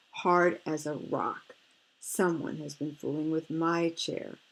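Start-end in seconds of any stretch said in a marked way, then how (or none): none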